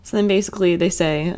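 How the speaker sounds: normal talking voice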